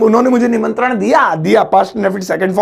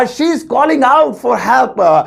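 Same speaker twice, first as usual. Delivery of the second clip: slow careful English; 3 semitones higher, much slower than usual. Shown as a demonstration. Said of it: "so English होगी".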